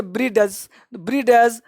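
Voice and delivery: "ब्रीडज" with a separate d before j; d sounded. Shown as a demonstration. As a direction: say "ब्रीडज" as many misread it, d voiced. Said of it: "बहुत सारे लोग इसको पढ़ लेंगे".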